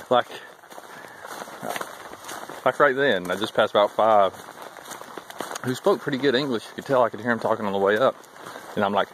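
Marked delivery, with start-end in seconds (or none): none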